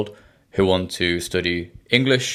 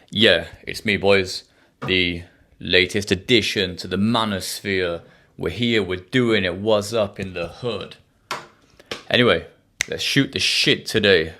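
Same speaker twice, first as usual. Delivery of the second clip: normal talking voice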